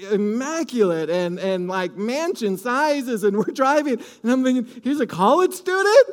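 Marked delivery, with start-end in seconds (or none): 4.8-6.1 incredulous. Retold or imitated. Astonished at the mansions.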